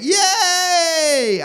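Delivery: high, loud, drawn-out yay; long squealed mock kiddie hooray; excited kids' cheer. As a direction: cheer like delighted small children. Children cheer the robot's promise.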